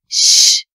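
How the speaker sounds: sharp loud unvoiced hiss; hissing shush. A narrator's reading. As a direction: hiss him silent. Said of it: "fauchte er".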